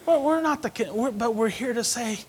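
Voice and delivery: usual speaking voice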